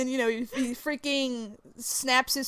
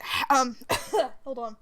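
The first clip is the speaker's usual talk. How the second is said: ordinary talk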